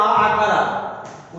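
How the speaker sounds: आ stretched very long and slow; drawn-out aa. Shown as a demonstration. Teacher demonstrating the long आ vowel to students. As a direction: hold the vowel in आकार आ. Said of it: "जैसे आ जाइए आ".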